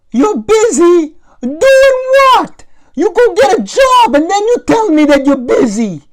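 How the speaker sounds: furious mom screaming down the hall; yelling old mother. Acted. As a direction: holler like an outraged old Italian mom.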